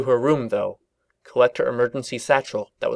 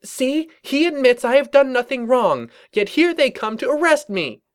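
high, loud indignant pony voice; angry young mare voice; 5 dB louder than usual. Acted. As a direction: accuse them in righteous anger. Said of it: "looked even more angry".

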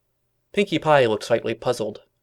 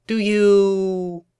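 high, loud, drawn-out words; pondering drawl; 10 semitones higher, 6 dB louder than usual. A narrator's reading. Pinkie searching for her next question, drawn-out pondering. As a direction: stretch it out while thinking. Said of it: "Pinkie thought for a bit".

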